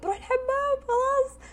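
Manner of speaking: high and loud, fed up; imitating her sister's exasperated reply; sister's retort. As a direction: announce she is leaving for the bathroom. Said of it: "قلت".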